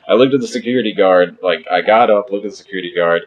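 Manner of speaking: ordinary talk, nothing marked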